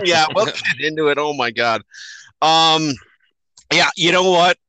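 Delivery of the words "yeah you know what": ordinary talk, nothing marked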